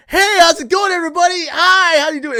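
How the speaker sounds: very high, loud sing-song hello; loud cheery greeting. Retold or imitated. Greeting coworkers loudly at the morning shift, high and loud. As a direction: belt out an over-the-top morning greeting. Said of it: "I was like".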